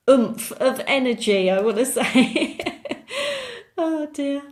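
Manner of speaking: breaking into laughter mid-phrase; real laugh through the words; giggling as she catches herself. Real, not acted. Cracks up at own reading.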